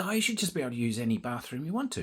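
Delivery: put-on fast, breezy West Australian accent. Con organiser chatting to the speaker, fast West Australian accent. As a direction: rattle it off like a chirpy West Australian. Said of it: "she's got the West Australian accent".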